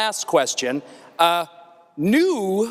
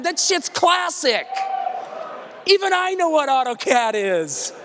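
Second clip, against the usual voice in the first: high, worked-up comic rant; hammed-up incredulous insistence; exasperated pleading; 7 semitones higher than usual. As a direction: plead your case, pitched way up, drawing out key words. Mock-pleading with guest to sell software.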